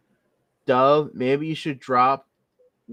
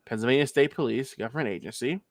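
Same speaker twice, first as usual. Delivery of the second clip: ordinary talk, nothing marked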